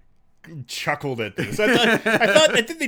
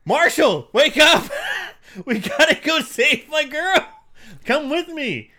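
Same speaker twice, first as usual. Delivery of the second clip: higher, louder urgent wake-up call through laughter; 7 semitones higher, 5 dB louder, slower than usual. Retold or imitated. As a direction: rouse your friend in a laughing hurry. Imitating himself rousing his friend.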